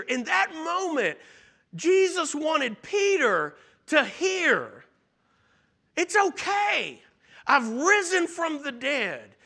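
preacher rising to a climax; fervent preaching. Acted. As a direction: lift the congregation with urgent conviction. Preaching builds to full force.

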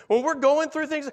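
ordinary talk

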